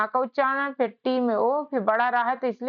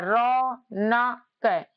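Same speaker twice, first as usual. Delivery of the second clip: Raunak stretched syllable by syllable, very slow; much slower than usual. Shown as a demonstration. Teacher stretching Raunak slowly for students. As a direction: pull Raunak apart slowly as रौ-न-क. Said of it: "रा में अ का उच्चारण है".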